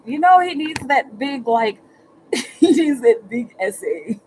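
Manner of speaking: high, loud, laughing gush; giggling. Real, not acted. Teasing about Miguel's big essay, high laughing gush. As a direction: giggle through it, delighted.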